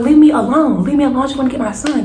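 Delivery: high-pitched retold outburst; retold yelling. Mother trying to get her son, retold yelling. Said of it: "I'm yelling over and over again".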